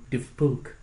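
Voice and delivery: light mock-French accent. Masked performer leaning close to a fan, mock French accent. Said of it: "said in a French accent".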